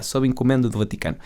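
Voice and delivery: usual speaking voice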